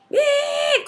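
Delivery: high-pitched mock beep of a car horn; a quick high honk imitation; imitated car horn. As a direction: squeal it like a honking horn. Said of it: "como me pita".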